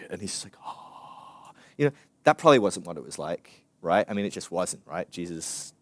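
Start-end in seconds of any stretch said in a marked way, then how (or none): 0.6-1.5 long, whispered airy "ah"; mock-ethereal sigh. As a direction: breathe out a soft heavenly sigh. Mock-ethereal movie Jesus.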